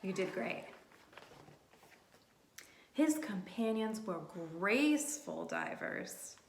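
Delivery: ordinary delivery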